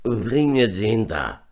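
slow, drawn-out shouted order; distant call. A narrator's reading. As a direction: call out as if from afar. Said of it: "wie aus weiter Ferne rufen". (langgezogen gerufen)

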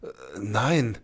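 lightly gasped, hesitant no; a touch of shocked gasping. A narrator's reading. Sepp grasps what Sybil means, gasping. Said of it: "keuchte er dann".

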